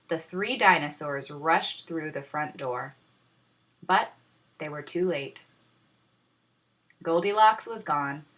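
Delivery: ordinary delivery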